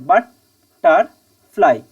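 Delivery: "Butterfly" slowly broken into three parts; syllable by syllable. Shown as a demonstration. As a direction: pause after each syllable of "butterfly". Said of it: "এই তিনটে". ("Butterfly" তিন টুকরো করে থেমে থেমে)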